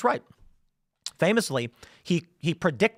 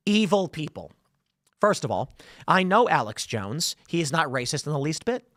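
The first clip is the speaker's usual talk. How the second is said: ordinary talk, nothing marked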